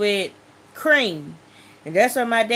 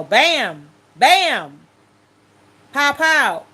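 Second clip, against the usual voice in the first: punchy mimicked bangs, the last pitched up; imitated gunshots; 4 semitones higher, 5 dB louder, much slower than usual. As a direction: fire each word off like a shot. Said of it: "I heard something go".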